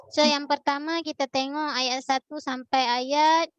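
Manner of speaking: normal talking voice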